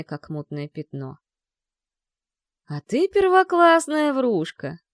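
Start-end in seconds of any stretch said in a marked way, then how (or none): 2.7-4.8 slow, high, loud mocking drawl. A narrator's reading. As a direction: drawl it out with scorn. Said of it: "насмешливо протянул Марк".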